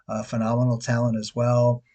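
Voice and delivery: ordinary talk, nothing marked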